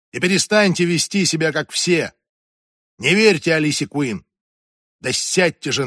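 exasperated, forceful scolding; irritated exclamation. A narrator's reading. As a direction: order the frightened girl about impatiently. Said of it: "раздражённо воскликнула Эмилия Арлингтон".

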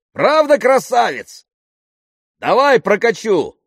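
high-pitched, exuberant bellow; yelling. A narrator's reading. Pilot offering his friend a ride, yelling. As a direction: holler across to your friend. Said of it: "заорал он".

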